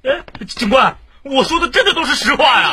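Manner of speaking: high, loud wail of protest; loud desperate plea. Acted. Suspect calling after departing officers, loudly desperate. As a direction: cry out to be believed. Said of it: "安静，安".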